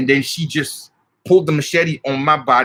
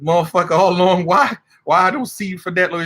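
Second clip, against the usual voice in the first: spirit cussing greeting; 6 semitones higher than usual. Retold or imitated. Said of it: "talk like".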